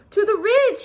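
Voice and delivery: grand proclamation. Acted. Walrus announcing the route to the pup, grand proclamation. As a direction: proclaim it chin up. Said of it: "he proclaimed".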